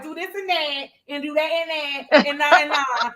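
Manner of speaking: mock accent, high and drawn into a chant; teasing high-pitched accent impression; Alicia. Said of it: "is that my accent".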